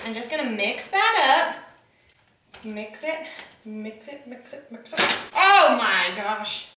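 ordinary talk, nothing marked

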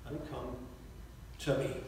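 "Let him come to me" almost hushed, low and weighty; quiet contrast. Shown as a demonstration. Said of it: "He doesn't say".

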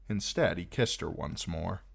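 plain reading voice

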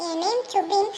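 ordinary talk

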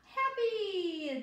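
a high, bright cheer; happy. Acted. Teacher cheers the emotion word for children. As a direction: throw the word up happily.

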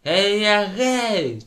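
high, long drawn-out whoop; shouted cheer. A narrator's reading. Pupils egging on a scuffle, long shouted whoop. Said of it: "можна було б покричати".

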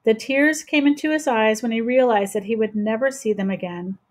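ordinary delivery

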